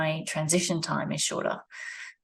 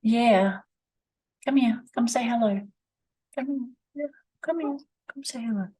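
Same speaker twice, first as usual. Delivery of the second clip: high sing-song pet talk; high-pitched coaxing to the dog; calling the pet; 7 semitones higher than usual. Real, not acted. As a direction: coax the dog over in a high sweet voice. Calling the dog over to greet.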